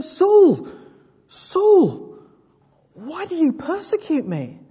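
high, loud calling voice from above; voice from heaven. Acted. Voice of Jesus calling Saul on the road, loud call. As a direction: call his name out of the light.

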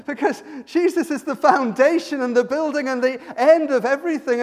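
loud, high, driving proclamation; impassioned preaching. Real, not acted. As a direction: preach it out at full force. Preacher builds to a climax.

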